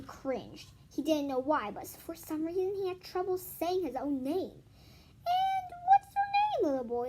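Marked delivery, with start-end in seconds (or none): 5.3-7.1 put-on sing-song adult voice to a little boy; adult asking. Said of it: "an adult would ask him".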